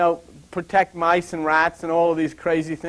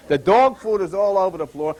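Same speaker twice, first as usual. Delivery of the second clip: ordinary delivery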